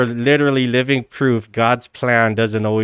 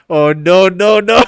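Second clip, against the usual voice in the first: rising, louder mock dismay; imitating a viral "oh no"; meme imitation; 5 semitones higher, 6 dB louder than usual. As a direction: build each no higher into a laugh. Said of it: "I forgot all about that meme".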